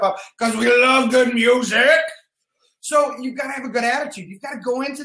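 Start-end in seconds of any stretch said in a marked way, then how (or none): 0.4-2.0 loud, drawn-out hype yell; excited shout. Real, not acted. Host hyping the team's love of music, excited shout. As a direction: belt it out with gusto. Firing up the morning livestream audience.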